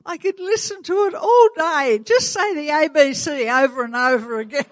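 high, bright-eyed gushing shop assistant; charmed shop girl. Retold or imitated. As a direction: gush like a delighted cashier hearing a new accent. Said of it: "and she said".

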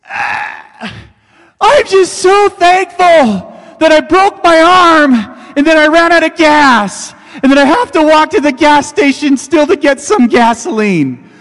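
high, loud, slow, strained fake thankfulness; mock pained gratitude. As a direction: gush through the pain, absurdly. Injured driver interviewed again.

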